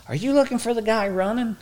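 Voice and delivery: put-on high old lady voice; lightly mimicked old woman asking. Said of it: "she says".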